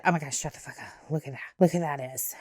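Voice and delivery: hushed, rushed imitation of a gushing friend; mock excited gush. Friend gushing at a Disney character, mock hushed and fast. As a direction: rattle it off fast under your breath like a starstruck friend. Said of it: "she said".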